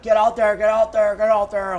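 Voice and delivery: mock dad shouting him out; pushy dad yelling. Dad yelling at his son to surf. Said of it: "my dad's like".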